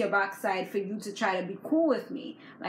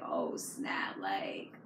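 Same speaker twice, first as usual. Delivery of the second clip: whispered, much lower, caught out; panicked whisper; 9 dB quieter, much slower than usual. Retold or imitated. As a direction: breathe it out like she's been caught. Imagines Jennifer's panicked thought.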